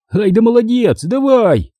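high, encouraging plea; coaxing. A narrator's reading. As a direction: cheer the dog on, voice lifted. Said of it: "упрашивал я его". (упрашивает собаку)

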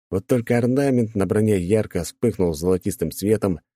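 plain reading voice

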